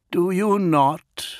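high, slow, daring question; bold challenge. A narrator's reading. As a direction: challenge him boldly. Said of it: "asked Praetor boldly".

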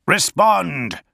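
high, forceful one-word command; hissed order. A narrator's reading. First Master demanding an answer over the Vox, hissed. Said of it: "he hissed".

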